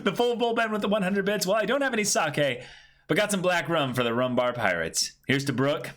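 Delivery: bright, high chat-reading voice, pitched well up; upbeat message read. Acted. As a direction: read the donation out like a cheerful announcement.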